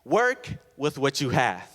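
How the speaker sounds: "work with what you have" slow, each word weighted; emphatic slow statement. Real, not acted. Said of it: "The first W".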